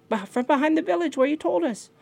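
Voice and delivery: very high bird voice; high-pitched, defensive chirp; little bird grandchildren. Acted. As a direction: answer back in a tiny high voice. Little birds answer Raven defensively.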